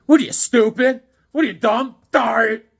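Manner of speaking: high, loud mock insult; mock yelling. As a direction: bark it at the viewers in fun.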